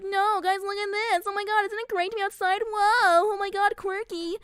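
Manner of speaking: high excited mock gushing; giddy character voice. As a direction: gush like an overexcited kid let outside. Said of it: "He's like".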